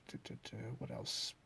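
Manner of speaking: usual speaking voice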